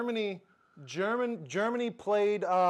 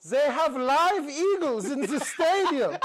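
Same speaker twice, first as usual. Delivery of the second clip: put-on higher, louder, laughing German accent; laughing imitation of a German tourist; mock German tourist; 7 semitones higher, 5 dB louder than usual. German fan filming Auburn stadium, in a laughing mock German accent. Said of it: "and they go".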